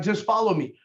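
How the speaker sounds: ordinary talk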